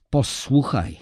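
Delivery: a drawn-out, clenched posłuchaj; wife on the phone, tight and clenched; through gritted teeth. Acted. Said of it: "wycedziła przez zęby".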